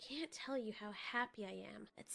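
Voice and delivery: whisper. A narrator's reading. Dreamy opening narration whispered.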